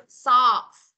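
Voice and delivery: loud "socks" with audible ending S; final S let out. Shown as a demonstration. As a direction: hiss the S at the end of "socks". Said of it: "các bạn nhớ sờ ra cho cô ha".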